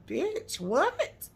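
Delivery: high, squeaky disbelieving what; stunned what. Retold or imitated. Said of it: "And I was like".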